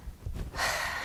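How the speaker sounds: a soft, breathy sigh of relief after a drink; a soft sigh after a cold drink; contented sigh. Acted. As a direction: let out a satisfied ahh after the water.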